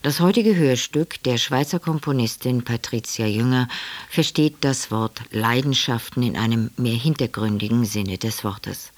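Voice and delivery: ordinary talk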